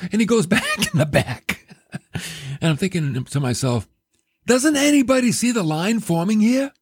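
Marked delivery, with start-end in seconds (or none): 4.5-6.7 higher pitched exasperated inner rant; incredulous complaint to no one in particular. Retold or imitated. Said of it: "I'm exasperated".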